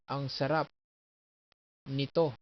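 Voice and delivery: ang sarap nito as sa-RAP, ni-TO; "ang sarap nito", each word end-stressed; stress on last syllables. Shown as a demonstration. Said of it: "So that's the accent".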